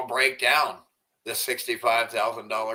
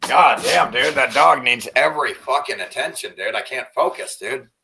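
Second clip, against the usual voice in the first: loud, exasperated complaint about the dog; fed-up yelling about the needy dog; frustrated outburst; 3 semitones higher, 8 dB louder than usual. Real, not acted. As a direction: vent loudly at the distraction. Dog distracting him on stream.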